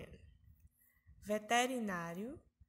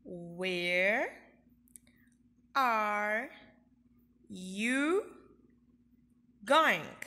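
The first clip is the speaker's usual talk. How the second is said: slow word-by-word question, going punched loud; teacher drilling a question for kids; question intonation, repeat after me; 4 dB louder, much slower than usual. Shown as a demonstration. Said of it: "Vamos repetir com a tia".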